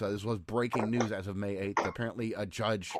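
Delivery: usual speaking voice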